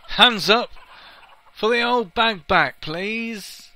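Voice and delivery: high, loud cheerleader call to the crowd; rallying call. Pretend. Gamer rallies viewers to vote for the old bag, loud and high.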